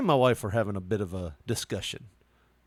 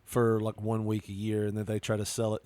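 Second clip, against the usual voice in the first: ordinary delivery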